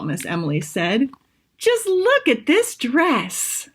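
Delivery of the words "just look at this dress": high, slow gushing over a dress. Acted. Miss Emily shows off the dress.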